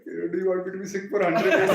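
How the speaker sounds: loud, quick question tipping into laughter; real laughing punchline; amused, laughing. Real, not acted. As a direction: deliver the punchline as laughter takes over. Laughs at own anecdote.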